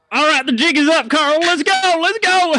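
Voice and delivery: high, loud hurried getaway call; high loud flustered cry to Carl; panicked bolt. Acted. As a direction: shout to your partner and run. Merchant flees, jig up.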